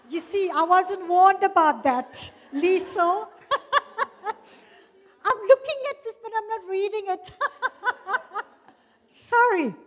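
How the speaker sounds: high, loud, laughing through the words; laughing apology. Real, not acted. Speaker tells Lisa she wasn't warned about the time, laughing high and loud. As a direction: protest merrily between bursts of laughter.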